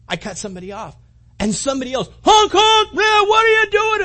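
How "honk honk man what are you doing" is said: shouted honks, much higher and louder; angry driver yell. Retold or imitated. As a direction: yell at the driver who cut you off. Other driver honking and yelling.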